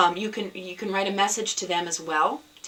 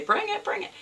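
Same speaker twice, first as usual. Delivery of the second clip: much higher, eager begging; eager bring it; 14 semitones higher than usual. Retold or imitated. Host tells her friends to bring their yarn, high and eager. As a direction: plead for the yarn like a kid.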